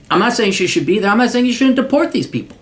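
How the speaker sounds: normal talking voice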